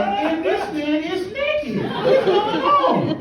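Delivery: high, slow, stunned; slow high-pitched "what's going on"; shocked disbelief. Retold or imitated. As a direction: squeal it out in disbelief. Stunned reaction to naked man.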